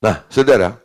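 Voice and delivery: ordinary talk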